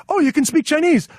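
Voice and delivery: high-pitched retold surprise; mock surprise. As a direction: act out someone caught off guard. Imitates caught-out locals' surprised reply.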